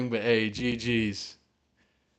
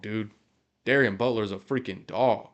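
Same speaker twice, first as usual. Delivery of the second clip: usual speaking voice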